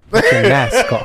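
loud high yelled rap line; yelling mask off; shouted lyric. Pretend. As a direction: belt it like a hype chant.

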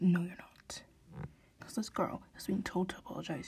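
hushed, unvoiced whispering of a rant; whispering angry diary confession. Real, not acted. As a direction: keep it a secret whisper so no one hears.

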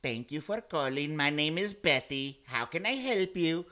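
slow, sugary call-center greeting in another voice; call center agent. Retold or imitated. Mocking the bank's call-center agent, slow and scripted. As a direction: put on the polite script voice of a bank agent. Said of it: "I get fucking pissed when I'm calling the bank".

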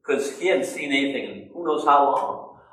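ordinary delivery

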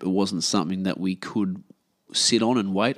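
usual speaking voice

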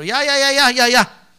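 rising to loud insistent demanding; impatient demand. Retold or imitated.

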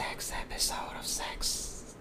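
slow breathy whisper. Pretend. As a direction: hush it like a secret. Whispering the show's title.

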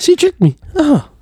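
very high echoed impression; mock complaint. Co-host echoing the impression, high mock complaint. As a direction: squeal it like a duped boy.

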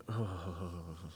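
long, soft exhaled sigh; heavy sigh. Real, not acted. Podcaster breaking off a rant about parents, long sigh. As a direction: let the breath drain out slowly.

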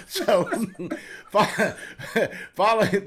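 trying to talk through laughing. Real, not acted. Storyteller cracks up telling his host, laughing.

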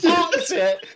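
high, loud laughing repeat; laughing disbelief. Real, not acted. Mocking the lion parked in the bike shed, laughing. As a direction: gasp it out through laughter.

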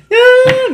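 sudden high, loud bawl; mock crying wail. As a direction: burst into an imitated wail. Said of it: "me puse a chillar así". (chillido de llanto imitado)

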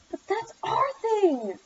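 high, drawn-out petulant whine; childish petulance, stretched out. A narrator's reading. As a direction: protest sulkily, pitched high and slow. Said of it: "petulant manner".

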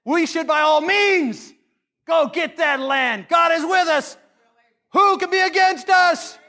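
rally the people like a brave scout; bold rallying call. Retold or imitated. Two spies urging Israel to take the land, shouting boldly. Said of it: "Two of them said".

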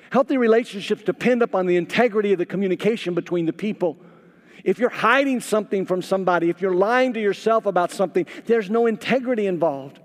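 loud, fast, high insistent preaching; urgent sermon build; preaching at full force. Acted. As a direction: drive the point home to the couple.

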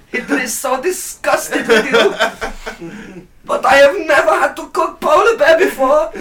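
high-pitched, hammed-up German officer; dodgy German accent. Mock Nazi officer berates the cook over polar bear meat, dodgy German accent. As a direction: scold in a comically bad German accent, half laughing. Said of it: "my dodgy German accent".

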